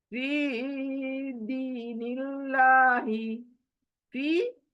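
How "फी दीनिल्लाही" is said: फी and दी both stretched in फी दीनिल्लाही; long vowels. Shown as a demonstration. Teacher models stretched फी दीनिल्लाही for the pupil. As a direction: draw out फी and दी slowly. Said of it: "जेर के बाद या मद्दा".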